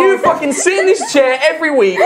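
half-laughing mock outrage, high; laughing rant. Real, not acted. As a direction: rant at him through laughter.

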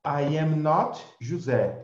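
not leaned on, slow and clear; slow teacher example stressing not. Shown as a demonstration. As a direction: hit the word not in I am not José. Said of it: "For example".

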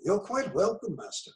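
soft, deferential British butler; bow and murmur it like a butler. Pretend. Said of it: "British, you know, butler voice".

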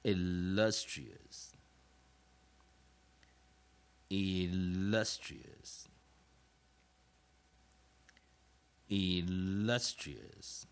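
very slow, stretched-out model pronunciation; word practice drill. Shown as a demonstration. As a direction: draw out each syllable for students to repeat. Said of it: "替这两个单词做一些练习".

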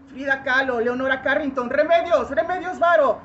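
loud roll-call of names, building; declaimed summons; rising invocation. Acted. Invokes women painters by name.

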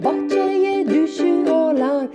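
ordinary delivery, nothing marked

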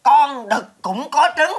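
slow, loud, high "Con đực cũng có trứng"; "Con đực cũng có trứng" declared slowly, defiantly; emphatic insistence. Acted. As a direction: spell out "cũng có trứng" word by word. Insists on his absurd claim.

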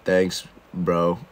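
deep mumbling store owner. Retold or imitated. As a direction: drop way down into a lazy deep bro voice. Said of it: "the store owner was like".

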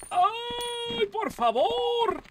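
high, drawn-out pleading cry; panicked wail. Real, not acted. Player begging the game for mercy, panicked wail.

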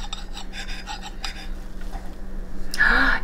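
sharp excited gasp. Real, not acted. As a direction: gasp in delight. Tasting bagel, big reveal.

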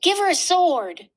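high, loud eager suggestion; excited. Acted. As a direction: blurt out the idea with delight. Excited idea after exclaiming.

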